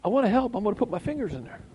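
high, quick eager voice; high hurried eagerness; eager meddler. Retold or imitated. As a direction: rush in like a kid wanting to help.

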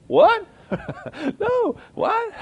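high, loud squeal of disbelief; mock shocked falsetto. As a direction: squeak out the shock, half laughing. Reacting to wife's words.

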